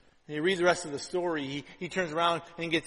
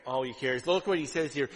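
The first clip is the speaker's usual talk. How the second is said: ordinary talk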